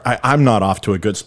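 ordinary delivery

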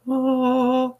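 high, held choir-like "ahh"; mock heavenly reveal. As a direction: sing a long, high note of revelation.

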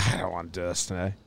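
low, very soft retold grumble; quiet, dragged-down reluctance; weary reluctance. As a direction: say it under your breath, drained. Retelling reluctance after early wake-up.

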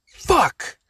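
imitated dad's high-pitched outburst; retold loud yell. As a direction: yell it like a fed-up dad. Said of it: "Yells it really loud".

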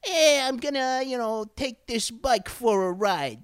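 high-pitched slow Brooklyn tough; cartoonish Brooklyn bad-kid drawl; movie bad kid, Brooklyn accent. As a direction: put on a movie street punk's Brooklyn voice. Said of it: "the bad kid that Francis associates with".

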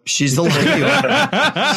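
laughing high and slow. Real, not acted. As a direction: laugh through the line. Echoing joke with laugh.

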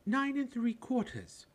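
put-on high, slow little girl's voice; piped small girl. A narrator's reading. Small girl piping the platform number to her mother. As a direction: pipe it up like a small child. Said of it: "piped a small girl".

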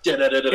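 rapid-fire tommy gun noise; quick mouthed gun chatter; imitated machine-gun fire. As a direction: rattle it off like a burst of gunfire.

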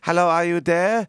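high put-on phone hello; retold caller. Acts out caller on prank line.

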